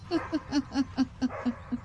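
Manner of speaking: soft, sly snigger; a quiet scheming chuckle; cunning advisor laughing to himself. Acted.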